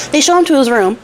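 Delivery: ordinary talk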